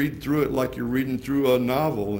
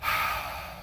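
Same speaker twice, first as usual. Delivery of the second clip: soft sigh; 6 dB quieter than usual. Acted. After Jesus' last words.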